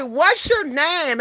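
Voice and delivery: high, drawn-out imitation of an admirer; smitten gushing. A smitten guy asking a stranger at a restaurant, gushing.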